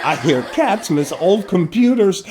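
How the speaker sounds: ordinary talk, nothing marked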